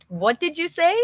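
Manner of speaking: high, indignant what-did-you-say; sassy retort. Shown as a demonstration. Teacher demonstrating a sassy comeback for students, high and indignant. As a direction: toss it back with attitude. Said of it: "That can be sassy".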